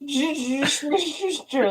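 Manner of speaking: slow slurred Juju Smith-Schuster; drunk slur. Shown as a demonstration. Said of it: "sound like you were drunk".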